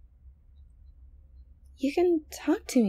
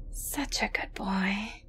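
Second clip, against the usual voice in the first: slow, soft whispered praise; 4 semitones lower, 8 dB quieter, slower than usual. Acted. Girlfriend whispering praise to her captive boyfriend. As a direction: whisper it slowly, like to a pet.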